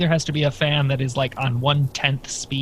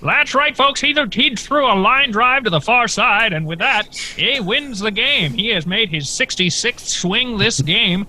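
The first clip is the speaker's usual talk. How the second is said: high, loud play-by-play, slowing at the end; frantic mock sports commentary; 8 semitones higher, 4 dB louder than usual. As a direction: call the game like a hammy sportscaster. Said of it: "I almost believe you actually know".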